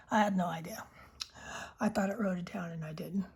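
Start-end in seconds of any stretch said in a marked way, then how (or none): 1.8-3.2 unvoiced ASMR whisper; soft whisper. Real, not acted. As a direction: breathe the words close to the mic. Whispered ASMR pen chat.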